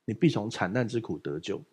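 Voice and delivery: ordinary delivery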